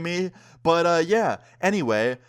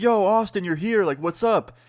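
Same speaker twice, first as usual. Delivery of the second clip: high, lively friend's hello; upbeat greeting; 6 semitones higher than usual. Retold or imitated. Friend walks in and finds Austin there, upbeat greeting. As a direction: greet a buddy you didn't expect.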